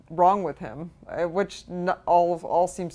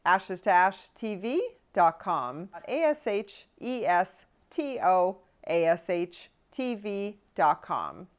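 slow spelled address; slower than usual. Shown as a demonstration. Host gives listeners the tip email address, slow and careful. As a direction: spell it out slowly, letter by letter. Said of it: "spells out the web address".

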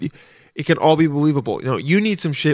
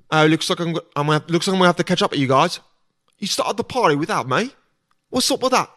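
hint of a Cockney quip; British parkour guy; 3 semitones higher than usual. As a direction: lightly imitate a cheeky British action hero. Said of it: "a British guy that's doing parkour".

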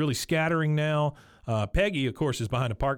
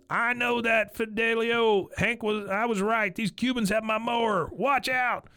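Dale ranting; 9 semitones higher than usual. Retold or imitated.

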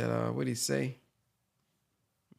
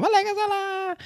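much higher, sing-song greeting; mocking the crowd's greeting. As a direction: mimic the members greeting each other. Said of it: "that's how y'all sound".

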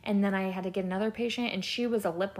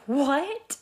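shocked what; 6 semitones higher, 4 dB louder than usual. Retold or imitated. Patient shocked by odd fear.

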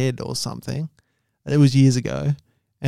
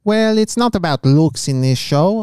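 a hint of a Chinese accent; lightly put-on Chinese accent. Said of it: "doing an accent".

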